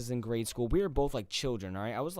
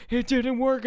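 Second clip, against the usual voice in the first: more panicked; 13 semitones higher, 9 dB louder than usual. Retold or imitated.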